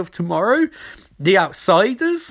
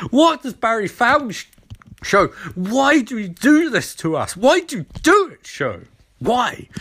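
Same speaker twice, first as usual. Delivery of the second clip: higher, pleading mock despair; comic outraged wail; exasperated rant; 6 semitones higher than usual. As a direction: plead in exasperation, swooping up on key words.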